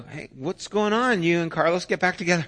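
eager parent. Retold or imitated. Parent asks smiling daughter about Carlos, eagerly. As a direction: perk up curious.

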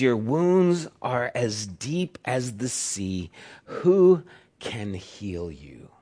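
slow, soft quoted lament; quoting the poet. Acted. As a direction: let the question hang, slowly. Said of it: "and he says".

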